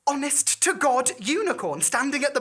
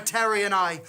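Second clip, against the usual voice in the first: plain reading voice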